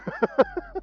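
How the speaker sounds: quick, very high giggle; real high cackling laugh; high-pitched laugh. Real, not acted. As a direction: burst of high, rapid laughter. Laughs at own question.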